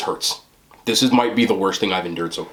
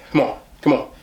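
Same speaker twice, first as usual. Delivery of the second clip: psyching up; 7 semitones higher than usual. Real, not acted. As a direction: hype yourself up to go. Psyching up for spicy bite.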